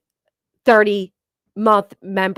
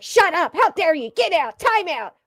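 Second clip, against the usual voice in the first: mocking moderators; 6 semitones higher, 3 dB louder than usual. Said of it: "They're like".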